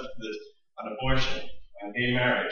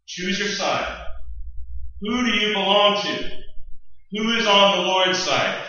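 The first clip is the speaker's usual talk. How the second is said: higher and louder, calling out to a crowd; rallying call; 7 semitones higher, 3 dB louder than usual. Acted. Moses calling the people to the Lord's side, raised voice. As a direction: summon the people to decide.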